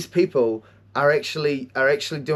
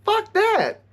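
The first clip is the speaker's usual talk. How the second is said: mock outrage; 7 semitones higher than usual. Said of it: "So I'm like".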